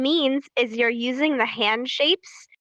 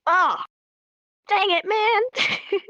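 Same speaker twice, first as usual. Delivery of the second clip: high, drawn-out playful complaint; mock frustration; 9 semitones higher, much slower than usual. Someone just interrupted mid-conversation, high mock frustration. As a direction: groan it high and slow, hammed-up. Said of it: "somebody interrupts you and you're like".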